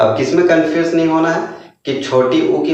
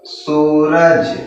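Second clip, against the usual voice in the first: drawn-out reading; much slower than usual. Shown as a demonstration. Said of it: "क्या पढ़ेंगे इसको?".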